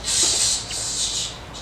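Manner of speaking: voiceless sh fading away; hissed sh fading. Acted. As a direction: let the sh die to nothing. Trailing off before taboo word.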